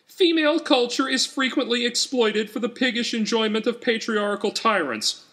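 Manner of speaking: high, shrill, ranting voice for her notes; high-pitched indignant screed; furious strident activist. A narrator's reading. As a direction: spit it out like an outraged campaigner. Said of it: "scrawled Danvers".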